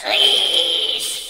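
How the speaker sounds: word stretched out very high and loud; high, playful elongated riche; drawn-out high cry. Real, not acted. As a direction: hold the vowel long on a high, loud note. Repeats word, last one stretched out.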